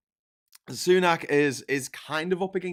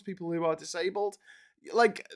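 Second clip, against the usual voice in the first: ordinary talk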